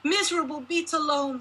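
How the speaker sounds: shrill-pitched mother shaming her daughter; raging mother. A narrator's reading. Mother berating her unmarried daughter, in a high raging voice. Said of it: "before storming out of the room".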